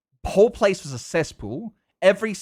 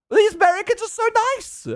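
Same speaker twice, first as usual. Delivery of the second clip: very high, louder gushing squeal; hammed-up delight; 5 dB louder than usual. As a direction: squeal it in exaggerated joy. Retells own delighted reaction.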